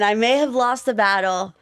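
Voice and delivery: ordinary delivery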